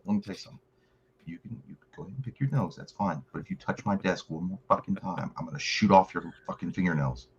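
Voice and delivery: quiet threat from behind the desk; mock menacing threat. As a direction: pretend president warning the kid, low and soft. Imagines threatening kid as president.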